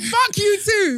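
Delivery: loud slow mock shout; playful shout. Real, not acted. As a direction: yell it back, teasing. Playful heated argument.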